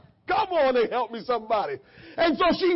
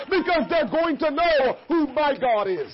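normal talking voice

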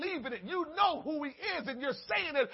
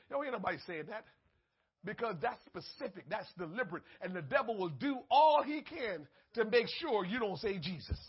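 ordinary delivery

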